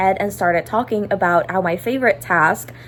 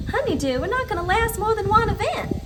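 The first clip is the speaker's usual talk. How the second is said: a Southern belle fairy accent, pitched up; Southern accent in a higher voice; Rosetta Southern accent; 8 semitones higher, faster than usual. Shown as a demonstration. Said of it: "the typical Rosetta Southern accent".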